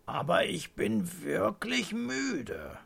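high, slow, drawn-out protest; tired grandpa protesting; weary protest. A narrator's reading. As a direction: insist plaintively, stretching the words. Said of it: "protestiert Opa".

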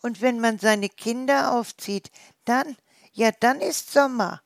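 put-on a mother recalling raising young; wild boar mother.

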